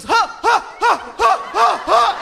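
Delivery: loud, high-pitched repeated gasps; hammed-up gasping for air; mock cardiac wheezing fit; fake heart-attack gasps. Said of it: "he fakes".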